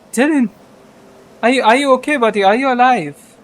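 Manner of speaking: high, loud, near-crying captain; a captain almost crying over his first mate; near tears. Acted. Captain calling to his first mate Dylan, almost crying. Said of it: "start to cry almost".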